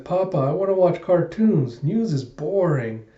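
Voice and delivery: put-on whining child.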